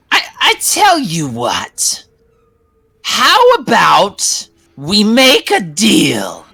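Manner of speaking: slow, high witch bargaining; scheming witch. Acted. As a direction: lure them into a deal. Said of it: "And she says".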